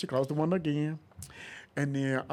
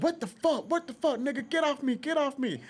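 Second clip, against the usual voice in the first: mock panicked yell; 11 semitones higher than usual. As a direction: squawk it like a man being grabbed. [name] yelling in background.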